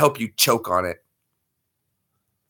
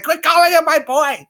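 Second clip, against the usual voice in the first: much higher mock-female voice; imitating her protest; 4 dB louder than usual. Imitating her objecting to the boy teasing, high mock protest. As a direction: squeal it in a high put-on voice. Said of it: "And she's like".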